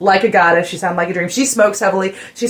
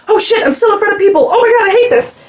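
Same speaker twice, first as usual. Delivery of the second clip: high, panicky imitation of the shy singer; mock panicked singer; 13 semitones higher, 4 dB louder than usual. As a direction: squeal it as if caught on stage. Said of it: "snap back in her body and be like".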